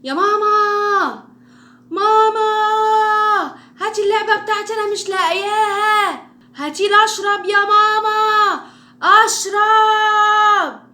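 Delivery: long, high, drawn-out yell for mum; piercingly high, very loud calling; yelling child. Shown as a demonstration. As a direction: holler demands across the house like a child. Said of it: "على طول عالي واحنا بنتكلم".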